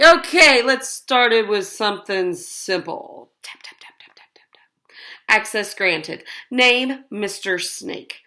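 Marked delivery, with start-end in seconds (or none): 3.4-5.0 breathy whispered tap tap; whispered typing taps. A narrator's reading. Hacker typing on the keyboard, whispered taps. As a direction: whisper the taps like keys clicking.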